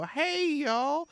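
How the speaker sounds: high, drawn-out imitation of a greeting; breezy hailing call. Game master echoing the player barging into camp, hailing call. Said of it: "you walk right into camp and you go".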